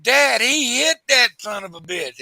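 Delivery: imitating a boy insisting to his dad, pitched high; high-voiced protesting youngster impression; excited kid. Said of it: "eleven or twelve-year-old kid back in the back".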